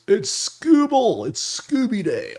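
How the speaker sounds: loud, high, drawn-out Australian whoop; sudden Australian accent. Pretend. Bettor spotting Scooble on the mound, sudden Australian accent cheer. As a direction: announce it in an excited Australian accent. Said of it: "I went Australian all of a sudden".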